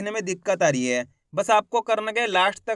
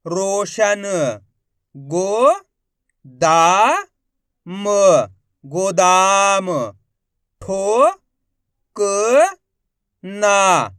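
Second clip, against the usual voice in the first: loud syllable reading drill; 6 dB louder, much slower than usual. Shown as a demonstration.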